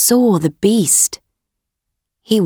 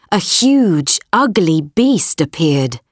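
ordinary delivery, nothing marked

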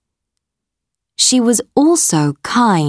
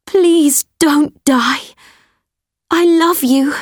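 pleading; 8 semitones higher than usual. Acted. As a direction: plead with a dying friend. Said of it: "she said".

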